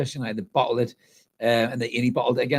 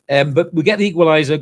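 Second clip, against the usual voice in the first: louder, lifted, fired-up announcement; excited; 4 semitones higher, 10 dB louder than usual. Real, not acted. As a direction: sell the goal with energy. Celebrating Newcastle's equaliser.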